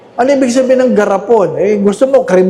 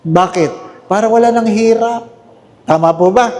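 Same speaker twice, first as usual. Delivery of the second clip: usual speaking voice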